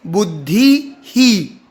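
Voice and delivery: बुद्धि ही, visarga as a short echoed ही; slow, clear बुद्धि ही; visarga echoing the vowel. Shown as a demonstration. Teacher models the right visarga, slowly. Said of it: "ई की मात्रा है और आगे विसर्ग है".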